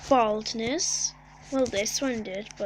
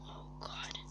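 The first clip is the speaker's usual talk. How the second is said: faint, breathy whispered dread; whispering; 18 dB quieter than usual. Real, not acted. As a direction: barely breathe it out. Hushed dread in blizzard.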